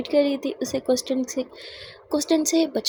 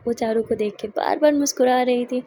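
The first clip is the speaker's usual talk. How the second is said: plain reading voice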